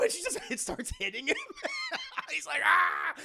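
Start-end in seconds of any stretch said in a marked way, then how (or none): 2.6-3.1 high, loud mock scream; yelp. Clown getting hit by Madea, high laughing yelp.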